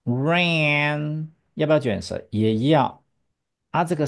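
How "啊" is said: ordinary delivery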